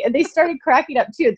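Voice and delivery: ordinary delivery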